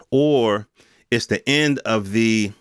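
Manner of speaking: ordinary delivery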